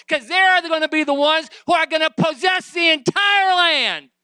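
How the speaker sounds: high, loud, driving sermon delivery; preaching at full force. Acted. Preacher urging the church to take their inheritance, full-force preaching. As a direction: thunder it out to the congregation.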